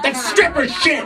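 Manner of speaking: punchline exclamation. Real, not acted.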